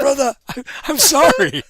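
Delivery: high, pleading sorry; apologetic. Retold or imitated. Comedian apologizing to the upset fan, high and pleading.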